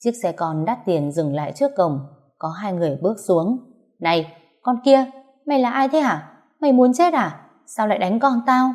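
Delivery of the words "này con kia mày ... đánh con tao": angry mother. Acted. Mother confronts attacker of daughter.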